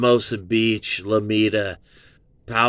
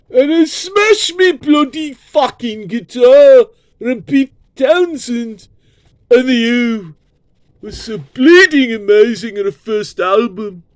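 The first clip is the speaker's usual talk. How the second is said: very high, loud squeaky Cockney shout; mock British rocker; 12 semitones higher, 7 dB louder than usual. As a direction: shriek it like a cartoon rock star. Mock Who voice again.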